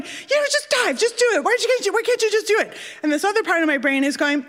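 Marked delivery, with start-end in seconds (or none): 0.0-2.7 high, urgent self-scolding, speeding up; frantic inner voice. Retold or imitated. Her brain urging her off the platform, frantic. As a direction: plead with yourself in a high-pitched panic. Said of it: "I'm freaking out".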